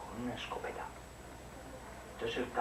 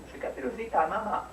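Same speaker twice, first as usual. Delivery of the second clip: put-on high, sweet little girl; 8 semitones higher than usual. Caperucita at the door to grandma, high girl voice. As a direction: pitch it up like a small girl. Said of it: "Y dijo Caperucita".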